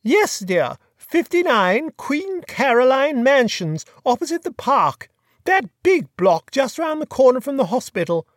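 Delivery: put-on chatty, high-pitched grand old lady; imperious plummy grande dame. A narrator's reading. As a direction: gossip like a fluttery duchess. Duchess gives address.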